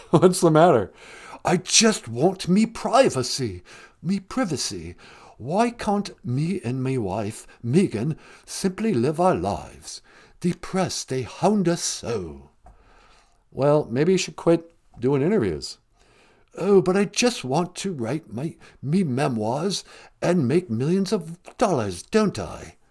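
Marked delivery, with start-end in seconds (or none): 1.4-12.5 Harry. Acted. 16.6-22.8 Harry. Acted.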